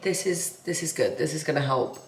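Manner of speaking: quiet, weary hungover voice; tired self-pep talk. Real, not acted. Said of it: "I'm like".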